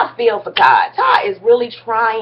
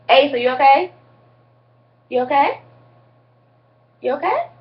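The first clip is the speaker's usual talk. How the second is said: high, softening coo to a sick pet; rising worried coo; 6 semitones higher than usual. Real, not acted. Talking to sick dog.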